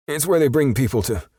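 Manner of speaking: high, loud frightened stammer; terrified perp. A narrator's reading. Terrified perp answering Justice at gunpoint, high and scared. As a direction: blurt it out in panic.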